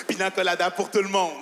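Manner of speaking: ordinary talk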